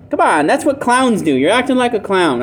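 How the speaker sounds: mild acted heckling; mocking. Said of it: "people are gonna mock this guy".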